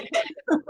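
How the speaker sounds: high-pitched, sudden laugh; a quick, bright laugh; burst of laughter. Real, not acted.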